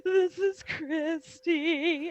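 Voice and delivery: high, loud, drawn-out wail; mock crying. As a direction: imitate bawling into the phone, very slowly. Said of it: "cried on the phone to Paul Bear".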